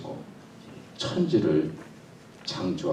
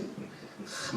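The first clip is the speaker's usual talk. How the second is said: normal talking voice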